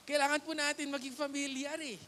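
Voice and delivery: ordinary talk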